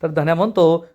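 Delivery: ordinary delivery, nothing marked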